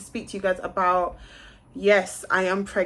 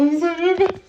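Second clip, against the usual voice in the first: laughing it out, much higher and louder; nervous laugh; 10 semitones higher, 8 dB louder than usual. Real, not acted. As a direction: giggle through the nerves. Laughs while waiting on test.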